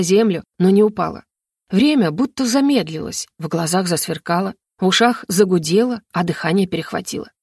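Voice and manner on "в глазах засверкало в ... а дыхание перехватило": plain reading voice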